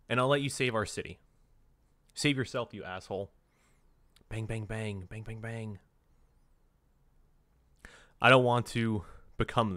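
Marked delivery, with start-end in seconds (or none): 4.3-5.8 soft, half-hearted spoken bangs; mock gunfire. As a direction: toss off the gunshots quietly. Voices gunfire in words.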